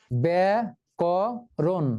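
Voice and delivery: ব্যাকরণ very slow, ব্যা-ক-রণ one beat each; teacher slowly splitting ব্যাকরণ into ব্যা, ক, রণ; syllables split. Shown as a demonstration. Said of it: "কয়টি অক্ষর?".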